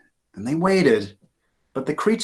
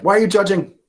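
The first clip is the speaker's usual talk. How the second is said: defensive; 9 semitones higher, 6 dB louder, faster than usual. Acted. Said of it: "And Ben's like".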